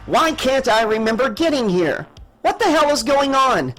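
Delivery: agitated demand. A narrator's reading. Confused captain questioning her pilot, agitated and high. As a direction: demand answers, pitch climbing.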